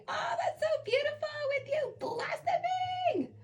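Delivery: very high, slow put-on coo; drawn-out falsetto gushing; gushing squeal. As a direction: gush in a tiny high-pitched voice. Gushing over the card mid-reading.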